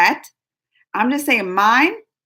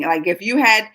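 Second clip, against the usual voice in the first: ordinary talk, nothing marked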